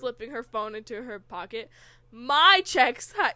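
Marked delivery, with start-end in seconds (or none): 2.1-3.1 MY blasted loud and drawn out; stress on My. Acted. Reader mocks the misspelt fanfic line, "My" hugely stressed. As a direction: read "My" as all capitals, long and loud. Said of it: "my is in all capital letters".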